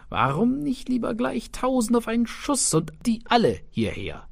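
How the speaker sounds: high, fast cocky tailor; boastful tailor. Acted. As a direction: brag it quickly and high.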